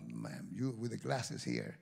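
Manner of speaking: usual speaking voice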